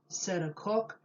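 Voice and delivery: usual speaking voice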